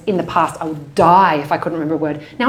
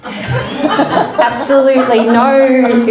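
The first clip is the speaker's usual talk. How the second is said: ordinary talk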